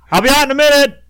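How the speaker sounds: high, put-on voice calling from the stall; high mock toilet voice. You to Jesus from the toilet, high mock voice. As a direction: squeak it out like you're stuck on the toilet.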